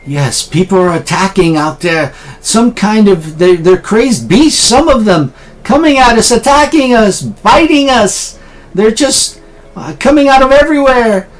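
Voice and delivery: high, frantic eyewitness voice; panicked survivor. Acted. Survivor warns the heroes of crazed beasts, high panicked voice. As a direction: report the attack in high, wailing alarm.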